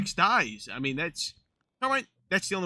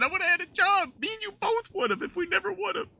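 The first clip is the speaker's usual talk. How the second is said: mock lament. Lamenting lost job to Carmine.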